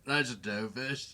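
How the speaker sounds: slow, drawn-out bad British accent, piratical; pirate-like British. Drummer mocking his own accent, pirate-like British. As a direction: stretch it like a pirate trying British. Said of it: "I sound more like a fucking pirate".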